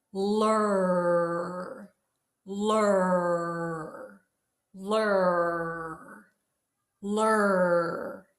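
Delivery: each "Lur" drawn out from L into R; L to R glide. Shown as a demonstration. Teacher drills class on changing sounds, sustained L-to-R glide. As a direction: slide the tongue back through a long "Lur". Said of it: "change it by pulling your tongue back".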